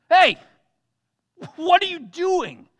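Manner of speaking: high, loud, slow comic talking donkey; Eddie Murphy Donkey. Retold or imitated. Balaam's donkey complaining to him, high and loud. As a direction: do the wisecracking donkey from Shrek. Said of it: "in the voice of Eddie Murphy playing".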